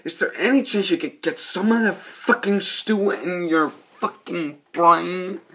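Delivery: hammed-up scorn at a dumb cop; mock exasperated insult. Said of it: "and he goes".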